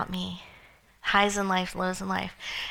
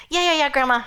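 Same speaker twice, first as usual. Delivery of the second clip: loud, high, dismissive yeah-yeah; impatient brush-off; 6 semitones higher, 9 dB louder, faster than usual. Retold or imitated. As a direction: rattle it off like a restless kid waving advice away. Brushing off grandma's advice.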